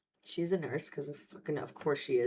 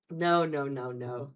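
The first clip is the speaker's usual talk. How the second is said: ordinary talk, nothing marked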